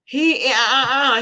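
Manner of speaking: ordinary talk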